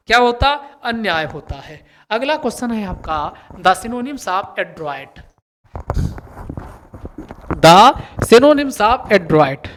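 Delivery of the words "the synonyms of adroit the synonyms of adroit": slow, drawn-out question reading; slow dictation. Shown as a demonstration. Teacher dictating the next question slowly. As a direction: read it out slowly for the class. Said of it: "teacher dictating question".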